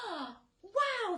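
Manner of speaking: high, loud wow; delighted cry. A narrator's reading. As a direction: burst out in delight. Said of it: "they cried".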